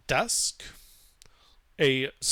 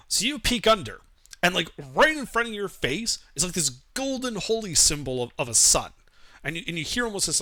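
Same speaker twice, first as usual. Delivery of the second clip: normal talking voice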